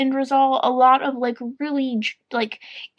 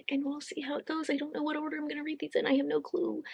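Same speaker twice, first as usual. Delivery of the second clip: whispering; 3 semitones higher, 11 dB quieter, faster than usual. Real, not acted. Aside to camera, voice drops.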